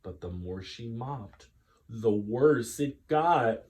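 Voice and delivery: ordinary delivery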